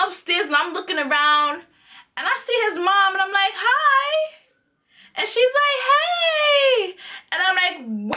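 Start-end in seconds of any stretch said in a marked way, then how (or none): 3.6-4.4 very high, drawn-out hi; retold sweet greeting. Retold greeting to boyfriend's mom. 5.9-7.0 very high, long-held hey; imitated mom's greeting. Mom's retold greeting back.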